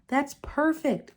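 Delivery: high and slow, thrilled; happy young mermaid thanks her father; delighted. A narrator's reading. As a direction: savor it like a lovely gift. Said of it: "Ariel said".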